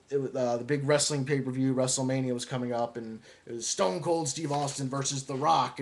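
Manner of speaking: normal talking voice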